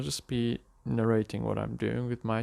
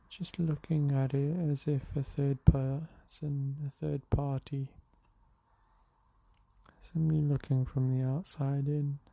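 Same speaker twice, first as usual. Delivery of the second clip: unhurried meditation voice with long pauses; guided meditation; slower than usual. Real, not acted. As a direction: guide them gently into the breath, leaving space between phrases. Leads a morning meditation.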